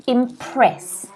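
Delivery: Impress mit Betonung auf der zweiten Silbe, press; stress on PRESS. Shown as a demonstration. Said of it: "notice the stress here is on the second syllable".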